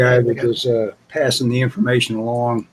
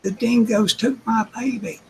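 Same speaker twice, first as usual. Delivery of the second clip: high hammed-up Australian quote of the line; mock Aussie movie line; 10 semitones higher, 3 dB quieter than usual. Friend repeats the movie line to the group, high mock Aussie wail. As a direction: echo the movie mother's cry in fun. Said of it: "She's making fun of the movie".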